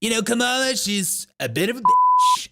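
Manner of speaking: high, hammed-up joking voice; facetious joking. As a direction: put on a teasing voice, pitch climbing to the end. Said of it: "he's just being facetious and".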